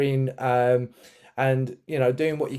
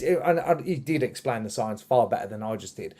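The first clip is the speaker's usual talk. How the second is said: normal talking voice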